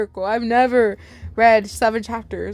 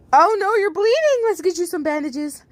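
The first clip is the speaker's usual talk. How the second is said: mild alarm; 8 semitones higher than usual. Acted. Sunrise alarmed at bleeding.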